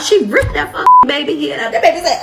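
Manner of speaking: high breathy wail of pain; imitated child's cry. Mimicking the little girl crying as her hair is ripped. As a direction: shriek like a kid whose hair got yanked.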